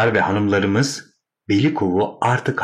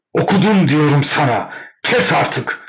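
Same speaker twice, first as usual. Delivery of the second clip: furious yell, high and loud; louder angry shout; 7 semitones higher, 4 dB louder than usual. A narrator's reading. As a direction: bellow at your sister to silence her. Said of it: "daha da yüksek sesle bağırıyordu". (daha yüksek sesle öfkeli bağırış)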